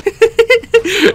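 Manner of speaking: high, loud burst of laughter; laughing. Real, not acted. Player botches the sneak attack, laughing. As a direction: crack up helplessly. Cracks up mid fight.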